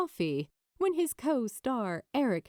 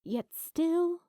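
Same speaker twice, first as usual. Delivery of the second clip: plain reading voice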